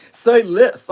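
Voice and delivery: put-on very loud Compton accent. Streamer repeating her impression while laughing, loud Compton accent. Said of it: "that's my Compton accent".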